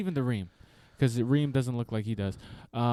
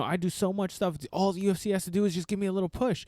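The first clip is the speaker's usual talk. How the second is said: higher, hurried imitation of pleading; mock a fighter pestering for a favour; begging; 6 semitones higher, faster than usual. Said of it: "It seems like you're begging".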